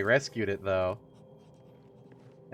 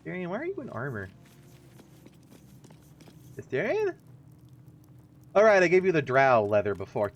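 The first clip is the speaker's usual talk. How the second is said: normal talking voice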